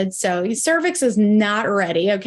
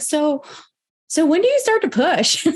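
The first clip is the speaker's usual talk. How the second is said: much higher, innocent mock-naive ask; naive high question; 8 semitones higher than usual. First-time mom asking nurse when to push, mock-naive.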